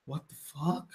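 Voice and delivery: very soft, acted disbelief; hushed mock shock; stunned murmur. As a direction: say it under your breath as a stunned onlooker. Retelling stunned Steve touching the dust.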